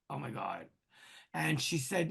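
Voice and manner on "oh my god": low, hushed disbelief; imitating a quiet, stunned reaction; stunned aside. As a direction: drop right down, softly awed. Retelling his stunned reaction to medium.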